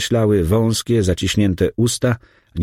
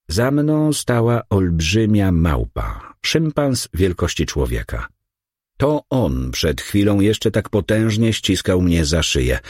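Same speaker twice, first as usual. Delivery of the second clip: usual speaking voice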